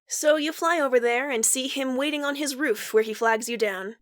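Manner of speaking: ordinary talk, nothing marked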